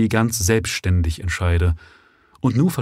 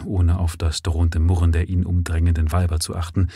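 plain reading voice

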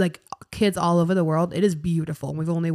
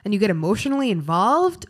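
ordinary talk

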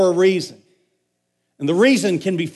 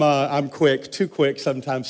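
laughing; 4 semitones lower, 3 dB quieter than usual. Real, not acted. Laughing at own slip.

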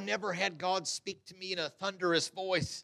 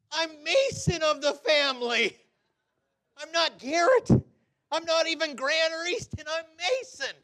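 high-pitched, pleading little-brother voice; protesting youngest child; 8 semitones higher, 5 dB louder than usual. Acted. Preacher playing Gideon as the family's youngest, high pleading protest. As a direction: protest your smallness in a raised, plaintive pitch.

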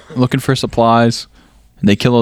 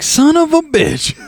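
high, drawn-out exclamation; mock outrage; slower than usual. Movie character spotting their car, mock outrage. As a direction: imitate a slow, high-pitched curse.

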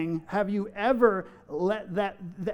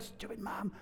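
whispered, imitated under-breath grumble; hushed mock mutter; muttered insult; 17 dB quieter than usual. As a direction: drop to a whisper for the secret insult. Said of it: "I never said".

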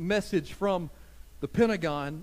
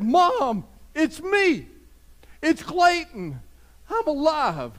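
son calling; 7 semitones higher, 6 dB louder, slower than usual. Retold or imitated. Son's voice on phone retold.

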